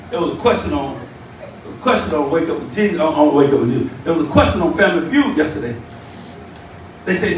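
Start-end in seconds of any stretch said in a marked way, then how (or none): none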